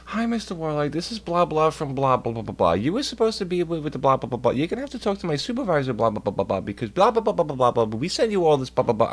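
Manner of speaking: high, quick office caller voice. Retold or imitated. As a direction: rattle it off like a busy clerk. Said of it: "they're like".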